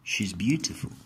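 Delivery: usual speaking voice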